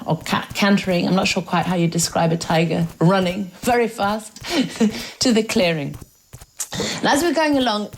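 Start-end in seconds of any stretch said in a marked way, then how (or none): none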